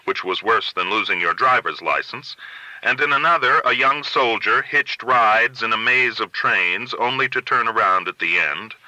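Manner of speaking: ordinary delivery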